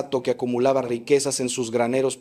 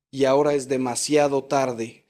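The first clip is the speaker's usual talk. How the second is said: ordinary delivery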